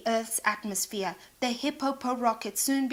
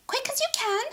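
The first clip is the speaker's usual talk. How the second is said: much higher, quick urging; 13 semitones higher, faster than usual. Acted. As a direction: hurry the builders along. Animal urging on builders.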